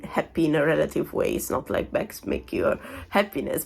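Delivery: ordinary talk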